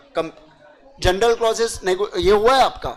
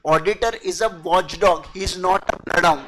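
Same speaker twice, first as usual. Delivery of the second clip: slow quoted maxim; 4 semitones lower, much slower than usual. Shown as a demonstration. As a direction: slowly recite the maxim word by word. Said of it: "बोला जाता है कथन". (कथन को धीरे-धीरे बोलना)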